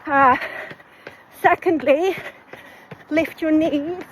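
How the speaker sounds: out of breath, talking mid-run; panting between short phrases; while running. Real, not acted. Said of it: "my breathing is just all over the place".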